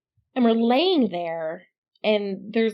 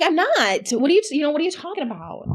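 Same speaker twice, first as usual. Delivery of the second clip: high, indignant protest; 7 semitones higher than usual. Retold or imitated. Wife protesting to her husband in bed, high and indignant. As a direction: defend yourself, baffled.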